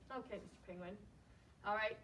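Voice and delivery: ordinary delivery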